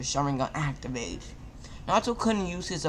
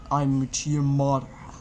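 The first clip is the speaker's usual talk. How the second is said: a hint of a deeper stranger's voice; 3 dB louder than usual. A narrator's reading. Masked man revealing himself to Naruto, lightly deeper. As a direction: lightly voice a darker, older man. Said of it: "a voice come deeper".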